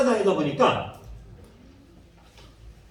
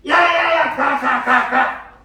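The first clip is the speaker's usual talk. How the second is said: much higher, loud shout chasing them off; disciples shooing kids; 6 semitones higher, 6 dB louder, much slower than usual. Pretend. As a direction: yell to drive the children away. 제자들이 아이들을 쫓음. (제자가 아이들을 쫓는 큰 고함)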